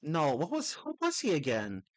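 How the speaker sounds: ordinary talk, nothing marked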